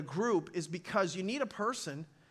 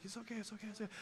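hushed, calming under-the-breath reassurance; quiet down a husband at the table; wife hushing her agitated husband; soothing whisper; 17 dB quieter, much faster than usual. Retold or imitated. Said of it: "putting her hand under the table".

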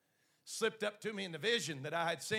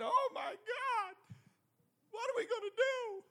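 acted tearful wail, pitched very high; mock weeping wail; 13 semitones higher than usual. Preacher acts out John despairing before God, high weeping wail. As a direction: draw it out in a high, crying voice. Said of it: "He starts weeping".

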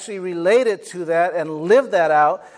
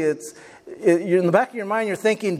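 normal talking voice

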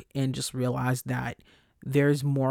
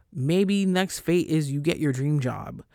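normal talking voice

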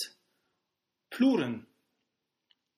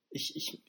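startled squeak; 11 dB quieter than usual. Real, not acted. Flustered squeak at sexual topic.

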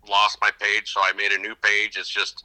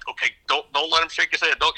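high, tense, anxious pleading; fretting that the fish will shake loose; nervous warning; 8 semitones higher than usual. Retold or imitated. Dad urging son while netting the big fish, anxious and high.

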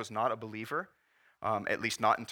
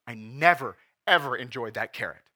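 pained lament; 8 dB louder than usual. Retold or imitated. Pastor voicing friend's lament.

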